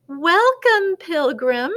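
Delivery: high, loud, ceremonial welcome; announcing. A narrator's reading. Head penguin greets the new pilgrim, announcing. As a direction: proclaim the greeting loudly, dropping at the end. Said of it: "the head penguin announced".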